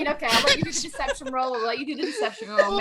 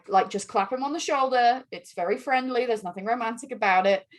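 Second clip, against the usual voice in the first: ordinary talk, nothing marked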